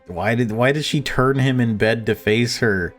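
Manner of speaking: normal talking voice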